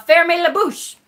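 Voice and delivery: mock French scolding. Shown as a demonstration. Playfully telling the viewer to shut up, "Fermez la bouche" in mock-stern classroom French. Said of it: "I could tell you to shut your mouth".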